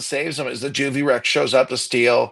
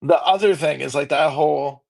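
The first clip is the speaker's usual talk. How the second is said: usual speaking voice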